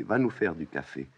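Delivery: normal talking voice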